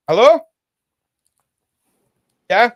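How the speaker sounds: high, loud hello to someone coming in; calling out. Real, not acted. Host calling to Dan who walked in, loud call. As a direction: call out to the room.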